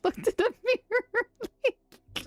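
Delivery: high, slow laugh through the words. Real, not acted. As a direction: crack up mid-line. Laughing at own joke.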